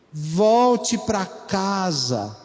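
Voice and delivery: Volte pra casa very slow and tender; slow appeal. Shown as a demonstration. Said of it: "um terceiro nível".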